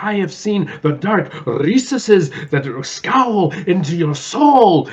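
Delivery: slow, high, booming dramatic pronouncement; hammed-up Gomez Addams gravitas; grand Raul Julia. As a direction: intone it like a tragic stage actor. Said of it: "and he's like".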